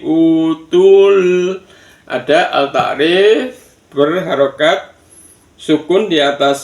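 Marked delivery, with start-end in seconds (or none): 0.1-1.6 utul with the first u drawn out; long u. Shown as a demonstration. Teacher repeating the long vowel, utul with stretched u. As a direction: chant utul higher, holding the opening u. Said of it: "u-nya mat tobi'i".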